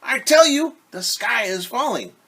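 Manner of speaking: high, loud indignant hen voice; insist, pointing at the bump; insistent hen. Acted. Henny insists.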